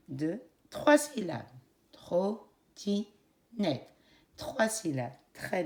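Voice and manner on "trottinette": Trottinette cut into three slow beats; syllable by syllable. Shown as a demonstration. Teacher counting syllables for the class, slowly. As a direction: tap out Trottinette one syllable at a time. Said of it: "comptez le nombre de syllabes".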